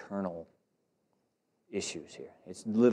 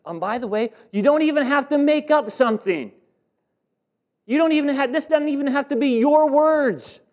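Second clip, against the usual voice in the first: loud, high, driving sermon voice; full-force preaching; 12 dB louder than usual. Acted. Pastor preaching Ezekiel's warning to the congregation, at full force.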